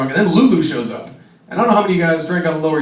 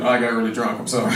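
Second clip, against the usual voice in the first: sheepish apology; 8 semitones lower, 6 dB quieter than usual. Retold or imitated.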